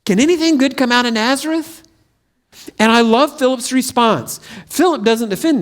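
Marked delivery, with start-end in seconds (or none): 0.0-1.8 skeptical scoff. Acted. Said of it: "That's what it was like for Nathanael".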